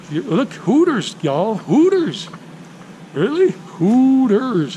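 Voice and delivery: high, drawn-out Hooters, hammed-up; excited exclaim. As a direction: stretch each Hooters with mock delight. Spotting Hooters, mock excitement.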